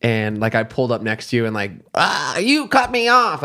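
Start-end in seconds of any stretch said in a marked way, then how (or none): 1.9-3.4 high, slow joking complaint; mock road rage. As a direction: pretend to be cut off, teasing. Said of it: "did it as a joke".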